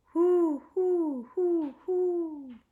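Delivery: high hooting owl call; loud, high, echoing owl hoots. Acted. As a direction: hoot it out like a great horned owl. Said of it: "The sound of a great horned owl".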